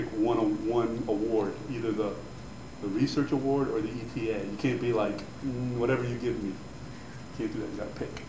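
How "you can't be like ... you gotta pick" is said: ordinary delivery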